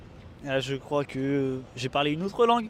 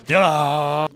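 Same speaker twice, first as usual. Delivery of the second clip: sudden loud call; 12 dB louder than usual. Acted. Calling out loudly to someone across the way. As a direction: shout it out to call them.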